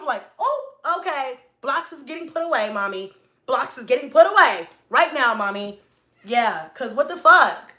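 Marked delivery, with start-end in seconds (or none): 0.4-5.8 high-pitched imitation of a compliant kid; obedient child. Son answering his yelling mom, eager compliance. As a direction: hurry to reassure in a raised kid voice.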